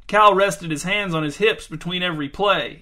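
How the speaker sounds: ordinary delivery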